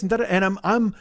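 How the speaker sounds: ordinary delivery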